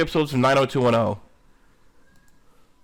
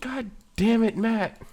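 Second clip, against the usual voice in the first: higher fed-up scold at Matt; host scolding Matt again, fed up; exasperated; 9 semitones higher, slower than usual. Real, not acted. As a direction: let out the exasperation. Repeats exasperation.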